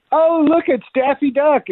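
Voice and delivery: high, excited mimicked woman; lady mimicked. Lady spotting the duck act, mimicked in a high voice. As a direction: squeal it as a delighted fan. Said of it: "and this lady said".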